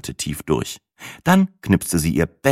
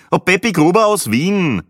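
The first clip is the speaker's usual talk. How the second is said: higher voiced Viennese man introducing himself; raised voice for tourist; 13 semitones higher, 5 dB louder than usual. Tourist from Vienna greeting the group, higher voiced. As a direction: play the cheery tourist a lot higher.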